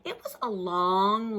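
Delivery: ordinary delivery, nothing marked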